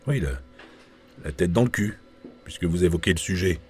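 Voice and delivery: ordinary delivery, nothing marked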